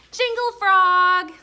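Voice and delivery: loud, high, slow shouted "Jingle frog!"; excited shout. A narrator's reading. Both children guessing the jingle bells together, excited shout. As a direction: shout it out triumphantly. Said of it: "said at the same time".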